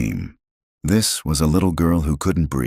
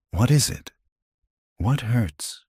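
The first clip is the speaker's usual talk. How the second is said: frightened, tender question; 8 semitones higher than usual. A narrator's reading. Frightened father asks child.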